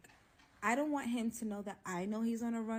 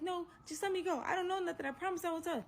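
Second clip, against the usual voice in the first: high, fast, frightened begging; panicked pleading; 11 semitones higher, 3 dB louder, faster than usual. Retold or imitated. Begging the man holding the door, high panicked pleading. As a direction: plead for your life. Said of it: "I'm panicking now".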